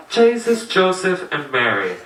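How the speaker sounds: put-on sigh-free Irish accent, sinking in pitch; Irish pilot. Pilot to co-pilot with mic on, imitated Irish accent. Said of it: "he says to his co-pilot".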